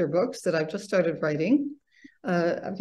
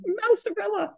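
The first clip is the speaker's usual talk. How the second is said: excited mock shout. A narrator's reading. Little dancers answer their teacher, excited high-pitched shout. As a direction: call it out high like eager children. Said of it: "raised their hands and shouted".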